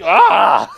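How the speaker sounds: loud, high mock yell of shock; imitated scream. Killer reacting to the snapped leg, mock scream. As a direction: yell out in comic horror.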